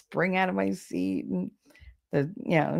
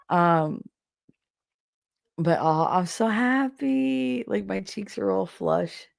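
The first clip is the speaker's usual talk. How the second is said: usual speaking voice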